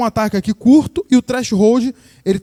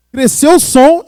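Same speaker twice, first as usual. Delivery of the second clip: higher, louder burst; falando alto; 6 semitones higher, 6 dB louder, slower than usual. Shown as a demonstration. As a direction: punch it loud. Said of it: "vou falar alto". (explosão de voz alta)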